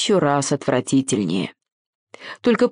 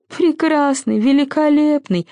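high, derisive echo; mock the grand words in a high voice; old spider's sneering, tittering repeat; giggling mockery; 9 semitones higher than usual. A narrator's reading. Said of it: "захихикал старенький паучок".

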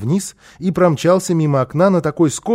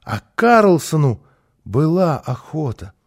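ordinary delivery

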